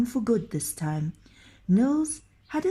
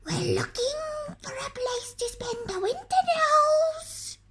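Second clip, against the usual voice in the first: troll voices. A narrator's reading. Troll voice from the trees.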